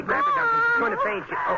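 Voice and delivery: high, frightened cry of a woman about to swoon; pretending to faint, crying out high and loud; staged fainting panic. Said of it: "thanks to the fainting act you staged".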